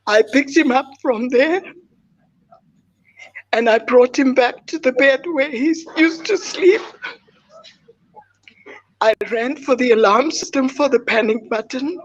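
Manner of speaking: telling it through tears; tearful. Real, not acted. Mother recounting son's death.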